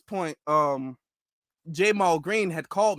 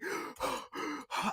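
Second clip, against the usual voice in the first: breathless gasp; 14 dB quieter than usual. Shown as a demonstration. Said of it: "trying to catch my breath without, like".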